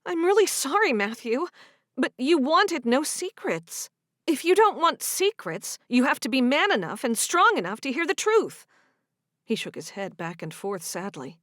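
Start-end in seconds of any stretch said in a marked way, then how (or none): 0.0-8.7 lightly voiced girl, higher and firm; a hint of a sorry but stubborn girlfriend; defensive young woman. Sigrid challenges Matthew to face the truth, higher.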